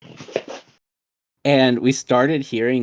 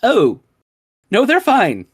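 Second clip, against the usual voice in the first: high, loud, retold "oh!" of understanding; mock-startled, pitched way up; sudden realization; 11 semitones higher, 6 dB louder than usual. Roommates realize what the moaning is, retold surprise.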